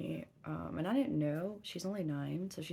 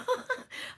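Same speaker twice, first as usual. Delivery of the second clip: sharp breathy high laugh; 6 dB louder than usual. Retold or imitated. Mom laughing while quoting herself to her daughter, breathy laugh. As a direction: burst out laughing mid-sentence.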